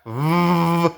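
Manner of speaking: long voiced "v-" hum; buzzing v. Shown as a demonstration. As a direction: buzz "v-" like a vibrating phone. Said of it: "como el sonido del celular cuando vibra".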